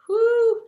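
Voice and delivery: loud high exhale bracing for a heavy topic; whew. Real, not acted. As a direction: blow out a big breath.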